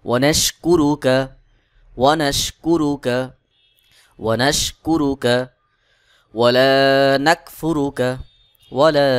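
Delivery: slow, measured Arabic chant with long-held vowels; Quran-style recitation. Shown as a demonstration. Teacher reciting Dua Qunut for learners to memorise, slow chanted recitation. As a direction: recite phrase by phrase, pausing for learners to follow.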